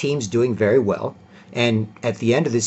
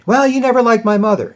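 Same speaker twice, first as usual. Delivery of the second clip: put-on high, sulky girlfriend voice; mock girlfriend accusing "never"; 12 semitones higher, 6 dB louder than usual. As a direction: pitch up to mimic her accusing.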